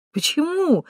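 high-pitched, breezy comeback; cheerful retort. A narrator's reading. Katka objecting to the narrator's disbelief, cheerful. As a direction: object merrily, voice lifting up. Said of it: "весело возразила Катька".

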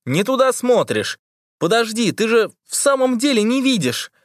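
put-on high boyish shout; excited shout. A narrator's reading. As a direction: call out, then realise. Toha shouting to Liza. (возбуждённый мальчишеский крик)

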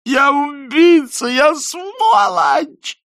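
put-on high, drawn-out drunken shriek. A narrator's reading. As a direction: shriek it out, beating your chest. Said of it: "завизжал он и стукнул себя кулаком в грудь". (пронзительный визг)

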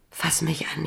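ordinary talk, nothing marked

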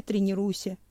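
ordinary delivery

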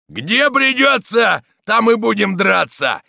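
loud, high, drawn-out war cry; full-force shout. A narrator's reading. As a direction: bellow it with all your might. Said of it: "крикнул изо всей силы камнелом".

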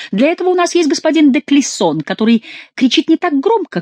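high, loud, pointed jibe; mocking retort. A narrator's reading. Chicot tells them de Clisson guards the king, mocking retort. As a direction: needle them in a lifted voice. Chicot mocks the king's men.

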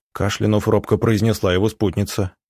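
ordinary delivery, nothing marked

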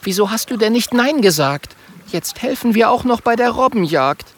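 high-pitched complaint; indignant, high little nag; reproachful. A narrator's reading. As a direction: scold a friend in a high voice. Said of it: "sagt Mathilda vorwurfsvoll".

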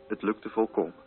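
ordinary talk

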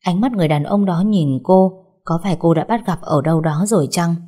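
plain reading voice

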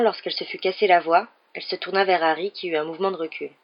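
ordinary delivery

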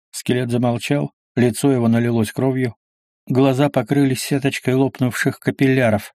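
usual speaking voice

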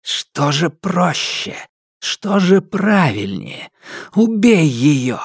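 high, slow tempting inner voice; demonic whisper. A narrator's reading. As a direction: coax him like a demon.

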